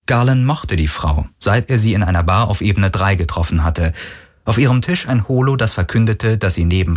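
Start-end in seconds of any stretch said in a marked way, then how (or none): none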